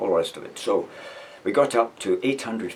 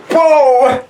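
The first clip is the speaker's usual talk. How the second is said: huge high shout, much louder; kick yell; 16 dB louder than usual. Retold or imitated. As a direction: bellow as the boot goes in.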